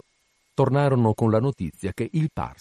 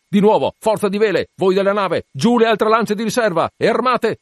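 high, loud captain's commands; urgent, raised sea-captain voice; shouted orders; 8 semitones higher, 4 dB louder than usual. Acted. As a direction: fire off orders to the whole ship.

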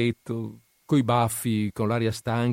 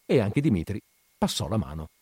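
ordinary delivery, nothing marked

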